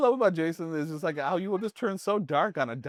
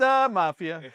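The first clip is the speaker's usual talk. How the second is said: put-on Chicago accent; 5 semitones higher, 4 dB louder, slower than usual. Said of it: "Like a Chicago".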